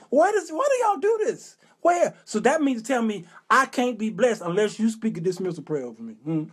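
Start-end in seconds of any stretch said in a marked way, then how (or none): 0.1-2.1 high-pitched baffled protest; exasperated questioning. Acted. Churchgoer questioning y'all's practices, exasperated. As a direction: demand an answer, voice shooting up.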